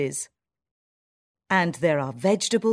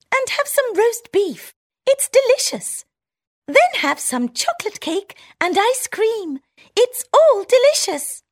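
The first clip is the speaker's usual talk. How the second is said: very high, louder squeaky mouse bragging; town mouse voice; 7 dB louder than usual. Acted. Town mouse offering her friend the feast, high proud squeak. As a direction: tempt her with treats.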